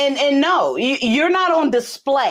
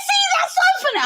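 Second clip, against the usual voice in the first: daughter's giggly reply; faster than usual. Retold or imitated. Daughter amused by her mother's tough talk, high squeal. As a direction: squeal it like a delighted teen.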